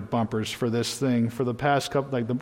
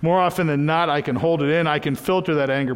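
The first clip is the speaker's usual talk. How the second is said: ordinary talk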